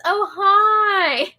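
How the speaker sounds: high, loud, playful greeting; excited greeting. Acted. Streamer reading a viewer's greeting aloud, playfully excited. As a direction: sing out the hello, high and loud.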